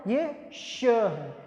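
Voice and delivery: slow, soft sh demo; stretched sh demo. Shown as a demonstration. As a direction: draw out श slowly and softly. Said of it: "ये स है".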